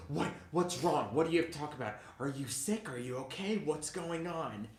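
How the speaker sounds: worried mom. Retold or imitated. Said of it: "she was like".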